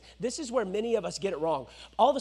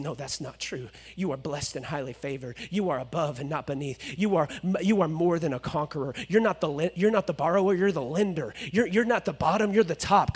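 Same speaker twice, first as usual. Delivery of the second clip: tender declaration; 7 semitones lower than usual. Acted. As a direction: speak life over them gently. Speaking blessings over listener.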